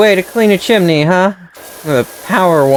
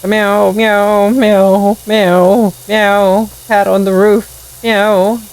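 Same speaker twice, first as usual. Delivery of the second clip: higher, plaintive mock cat meowing; cat meows; 5 semitones higher, slower than usual. Cat stuck on roof calling for help, higher mock meows. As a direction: cry like a kitten stuck up high.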